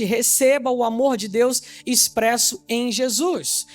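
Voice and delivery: usual speaking voice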